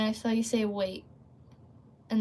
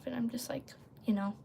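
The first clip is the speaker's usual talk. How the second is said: whispered aside; 9 dB quieter, faster than usual. Real, not acted. Streamer whispering an aside to her viewers, hushed.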